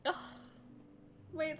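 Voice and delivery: ordinary talk, nothing marked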